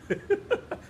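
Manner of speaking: short high chuckle. Real, not acted. Preacher talking of bad manners toward scholars, short chuckle. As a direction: let out a brief high laugh.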